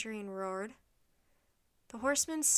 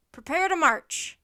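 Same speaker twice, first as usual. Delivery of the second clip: centurion's drawn-out marching order, loud; shouted command; 5 semitones higher, 13 dB louder than usual. A narrator's reading. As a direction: shout it slowly to the troops. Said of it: "the centurion shouted".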